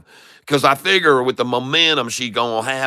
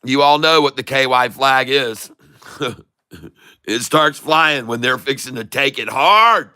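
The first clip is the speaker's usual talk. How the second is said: ordinary talk, nothing marked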